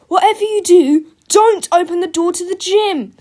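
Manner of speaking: high, loud warning; desperate cry. A narrator's reading. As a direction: shout a frantic warning. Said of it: "cried George".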